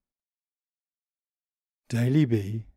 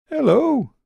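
high, small puzzled bunny voice; a high, wondering little creature; Daily B's voice; 9 dB louder than usual. A narrator's reading. Daily B greets.

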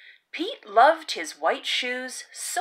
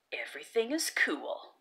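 quiet aside; 13 dB quieter, faster than usual. A narrator's reading. Cool aside after narration.

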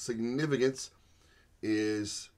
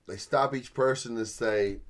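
ordinary talk

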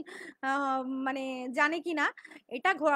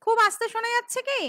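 louder, higher and fast; to someone hard of hearing; 6 semitones higher, 7 dB louder, faster than usual. Real, not acted. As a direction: call out so they can hear. Audio trouble, raising voice to listeners.

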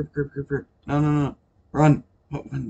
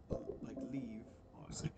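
whispering; 3 semitones lower, 24 dB quieter than usual. Real, not acted. Stifled aside while recording.

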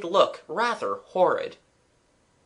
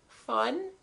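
small, high, soft doubtful echo; dismal, doubting; 10 semitones higher, 5 dB quieter than usual. A narrator's reading. Girl echoing her brother's claim of fun, dismal and doubting. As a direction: question it dismally, softly and high. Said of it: "dismally and doubting".

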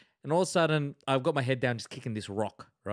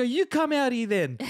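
retold challenge; 9 semitones higher, 3 dB louder than usual. Retelling defiant answer to truck owner.

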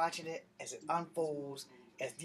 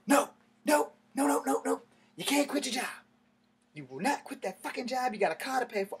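higher and louder, firmly refusing; a mother shutting the idea down flat; stern mom; 9 semitones higher, 5 dB louder than usual. Retold or imitated. Mom forbids quitting.

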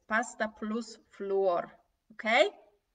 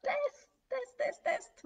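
very high, squeaky sing-song; playful high squeal; slower than usual. Acted. Teacher teasing students about a coming quiz, squeaky high chant. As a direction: tease them with a squeaky chant.

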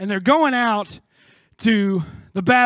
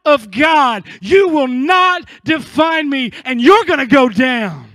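loud, slow, higher, hammering each line; defiant declaration; 4 semitones higher, 6 dB louder, slower than usual. Acted. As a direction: shout down your guilt. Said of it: "look at that guilt ... and go".

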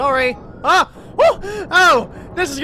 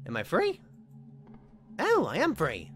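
usual speaking voice

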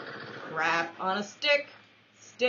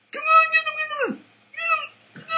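squealing cheer; 6 dB louder than usual. Real, not acted. Cheering on a teammate.

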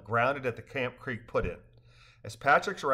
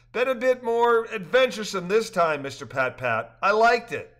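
higher, louder lively dialogue; cheerful characters; 8 semitones higher, 6 dB louder than usual. A narrator's reading. Rafters joking after the trip, higher and louder. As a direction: voice the rafters brightly.

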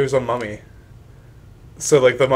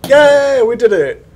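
high, loud victory cheer; excited cheer; 10 semitones higher, 7 dB louder, slower than usual. Real, not acted. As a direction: cheer the win. Celebrating the win.

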